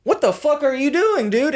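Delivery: high-pitched exasperated pet; voiced dog. Dog asking his owner what he's doing, high exasperated voice. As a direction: play a dog pleading with its owner, voice way up.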